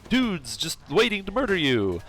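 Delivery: high-pitched mock villain bluster. Villain threatening the player after his plan fails, blustering. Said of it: "and he's like".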